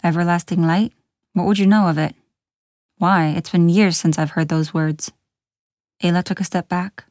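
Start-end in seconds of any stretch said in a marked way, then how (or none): none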